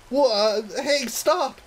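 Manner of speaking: high, surprised cry of alarm; startled yelp. Acted. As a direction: yelp in shock, pitch jumping up. Girl startled by grab.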